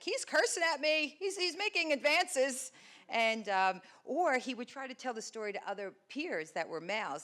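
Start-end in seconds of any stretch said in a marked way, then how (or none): 0.0-2.7 mock complaining staff. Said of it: "They're like".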